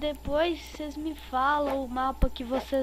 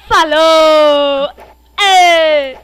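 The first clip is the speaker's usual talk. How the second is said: loud high shout; 4 semitones higher, 24 dB louder, much slower than usual. Real, not acted. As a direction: yell it out. Shouting at player.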